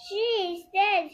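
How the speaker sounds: high, loud alarmed cry; dwarf's shout. A narrator's reading. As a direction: shout the news to the others. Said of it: "shouts the clumsy dwarf".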